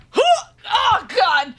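real flustered squeal; flustered shock. Real, not acted. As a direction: react like it caught you off guard. Her own reaction while reading.

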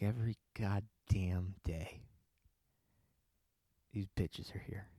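hushed thought, slowing down; thinking it. Acted. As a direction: grumble it softly as an inner thought. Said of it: "He's thinking it".

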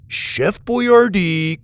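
calling out. Acted. Dallas calling the chef bot.

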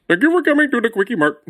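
high, put-on Apu voice, cheerful shopkeeper; chirpy Apu greeting at the store counter; Apu impression. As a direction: greet a customer as Apu would. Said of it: "that is worse".

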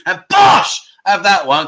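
triumphant bellow of bosh; a big celebratory yell of his catchphrase; catchphrase shout. Acted.

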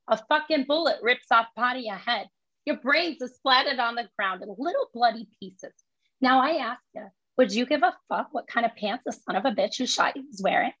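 Lisa's My Cousin Vinny speech in thick Brooklyn; New York accent, pushed up and punchy. Acted. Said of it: "So it's New York accent".

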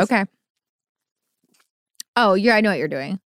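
while sipping a drink. Real, not acted. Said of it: "sloshing it in your mouth".

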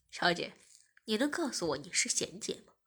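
lightly voiced serious man. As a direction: ask it in earnest. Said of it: "技师正经地说".

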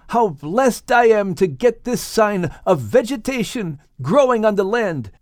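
father rejoicing over the sign; exclaiming Noah. Acted. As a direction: praise God with joyful relief. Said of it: "Noah exclaimed".

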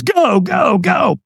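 high-pitched imitated yell; urgent shout. Trainer sending the walker onto the coals, urgent shout. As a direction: yell it like launching someone forward.

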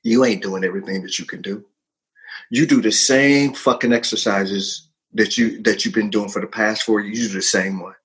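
low, measured voice of conscience; inner voice. Acted. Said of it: "So the voice said".

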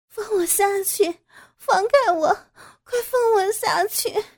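high, loud frightened cries; panicked shouting. Acted. Daughter-in-law struggles against father-in-law, panicked shouting. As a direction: shout in terror to be let go. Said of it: "惊恐地叫喊".

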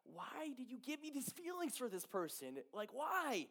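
high-pitched retold lament to God; confused plea. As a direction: ask why in bewilderment, voice lifted. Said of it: "I'm just depressed and just confused".

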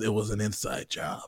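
low, soft mock crime-show line; lightly played TV investigator, dropped low and quiet; TV detective. Dude on a TV crime show delivering the verdict, low mock-detective voice. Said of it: "the dude puts out a cigarette".

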